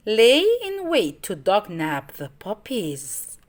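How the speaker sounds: ordinary delivery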